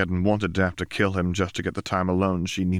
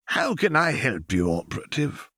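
put-on higher, frail voice of a tottering old man; old archivist; 6 semitones higher than usual. A narrator's reading. Old archivist greets Lux, higher and frail. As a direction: offer help like a doddering old keeper.

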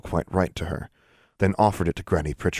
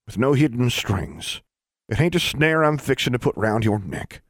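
reassuring; 6 semitones higher than usual. A narrator's reading. Granny reassures Alexandra.

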